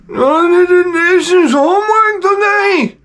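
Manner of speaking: very high, slow, exaggerated Indian accent; drawn-out, high-pitched mock Indian accent. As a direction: put on a squeaky caricature Indian accent. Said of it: "the Indian accent is the funniest accent".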